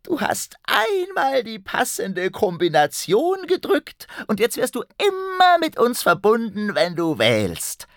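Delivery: very high, drawn-out goblin voice; very self-satisfied goblin. A narrator's reading. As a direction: gloat slowly like a pleased little goblin. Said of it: "die überaus zufrieden klang".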